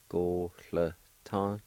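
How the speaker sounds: "Gólatan" said very slowly, syllables drawn out; slow demo. Shown as a demonstration. Teacher modelling "Gólatan" slowly to learners. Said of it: "and together".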